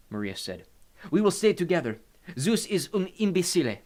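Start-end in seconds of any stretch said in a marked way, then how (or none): 1.1-3.8 put-on high, loud, defiant Italian accent. A narrator's reading. Maria defies Zeus before Hades, Italian accent. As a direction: defy a god in an Italian accent. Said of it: "she spoke with an Italian accent".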